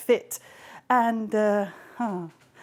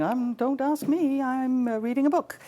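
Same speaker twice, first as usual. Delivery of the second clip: evasive gods; 6 semitones higher than usual. Acted. Gods dodging Zeus.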